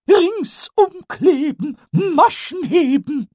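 very high, slow, squeaky goblin chant; a cheeky little kobold reciting a rhyme; Pumuckl voice. Acted. Pumuckl chants his rhyme to himself, high and slow.

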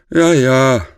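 high, loud drawn-out call back; sleepy call. A narrator's reading. Bert answers his mother from upstairs, sleepy call. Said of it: "rief Bert verschlafen".

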